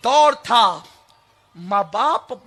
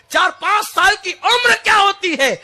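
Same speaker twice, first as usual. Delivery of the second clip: much higher and louder on the repeat; shouted repeat; 9 semitones higher, 6 dB louder than usual. Shown as a demonstration. Preacher repeating the question to the crowd, now shouted. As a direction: hammer the question home. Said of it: "غور تو کرو".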